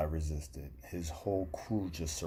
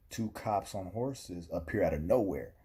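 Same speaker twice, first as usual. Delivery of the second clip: ordinary delivery, nothing marked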